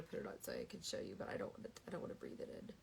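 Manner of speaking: whispered, holding her breath. Real, not acted. Avoiding smelling chai, she whispers. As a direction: whisper so you won't breathe it in.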